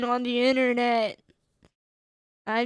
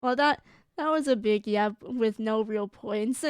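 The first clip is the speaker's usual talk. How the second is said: usual speaking voice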